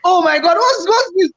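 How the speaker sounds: 3 wail it out like the world ended; 1 distraught disbelief. Retold or imitated. 4 creator crying over zero subscribers, frantic high disbelief. Retold despair at zero subs.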